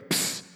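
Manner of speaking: crisp fizzing psst; imitated beer can opening. Comic imitates beer fizz for the crowd, sharp hiss. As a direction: make the hiss of a can cracking open. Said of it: "sound of carbonation being released".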